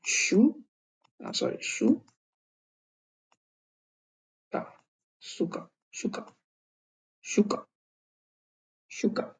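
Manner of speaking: शुका sounded out, the first syllable whispered. Shown as a demonstration. Teacher sounds out शुका for students, partly whispered. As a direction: breathe out शु, then शुका whole. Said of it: "यहाँ पे pronounce है शुका".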